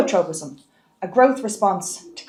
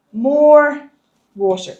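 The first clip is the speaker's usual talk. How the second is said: long, loud "more"; stress on "more"; 5 semitones higher, 6 dB louder, slower than usual. Real, not acted. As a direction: stretch "more" out firmly, then let "water" drop back. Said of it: "I'm emphasizing the word more".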